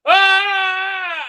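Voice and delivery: long, loud high-pitched scream; mock scream. Crazy fan horrified by heresy, long mock scream. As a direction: shriek in hammed-up horror. Mimics outraged crazy fans.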